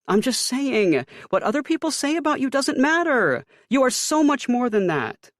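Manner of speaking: high, loud insistent mother; Ma insisting. A narrator's reading. Mother telling her son others' opinions don't matter. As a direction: reassure him as Mom, voiced high. Ma voiced in story.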